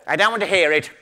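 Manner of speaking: loud, lightly voiced spider. Spider cutting off the fly, lightly voiced loud refusal. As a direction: refuse it outright. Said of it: "said the spider".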